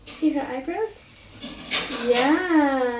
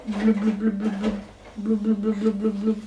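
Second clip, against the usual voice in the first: low blub blub bubbles; playful low bubbly blubs; fish blubbing; 5 semitones lower, faster than usual. Acted. Parent voicing fish.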